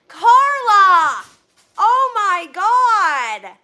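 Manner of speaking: loud, high, drawn-out exclamation; shocked outcry. Real, not acted. Streamer to a generous donor, stunned. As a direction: cry out, truly floored.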